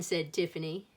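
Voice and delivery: usual speaking voice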